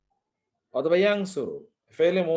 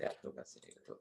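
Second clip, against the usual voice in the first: whispered aside; 18 dB quieter than usual. Real, not acted. Teacher joking about zoom.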